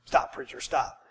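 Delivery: hushed mock protest from the pew; whispered plea. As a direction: whisper stop, preacher. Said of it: "And you're going".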